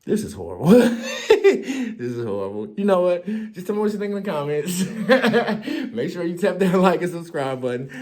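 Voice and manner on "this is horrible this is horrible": real laughter breaking through the words; laughing at himself. Real, not acted. Vlogger catching his own joke, laughing. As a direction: laugh it off, half embarrassed.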